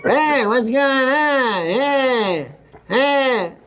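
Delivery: high, slow, drawn-out hey-greeting; hammed-up high drawl, parodying oneself; self-impression. Shown as a demonstration. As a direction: exaggerate your own voice, stretching each word up high. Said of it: "That's the impression".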